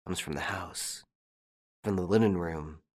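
low, very soft murmur; under his breath. A narrator's reading. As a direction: say it low, barely audible. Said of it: "under his breath".

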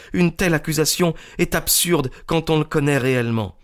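put-on high, earnest young woman's voice; high, insistent young woman's voice; young Miss Turner. A narrator's reading. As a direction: plead passionately for the man she loves. Young woman pleading for James.

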